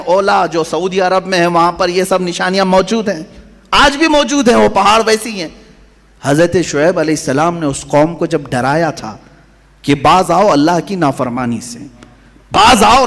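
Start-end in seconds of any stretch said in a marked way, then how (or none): none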